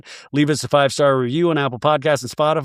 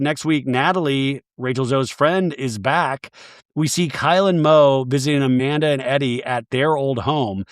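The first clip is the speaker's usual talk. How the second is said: ordinary talk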